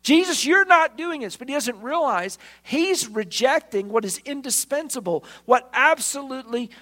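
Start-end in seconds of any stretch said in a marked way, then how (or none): 0.0-1.4 high, loud, insistent no; emphatic refusal. Acted. Peter telling Jesus he won't allow it, emphatic and loud. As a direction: put your foot down hard. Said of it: "Peter's emphatic".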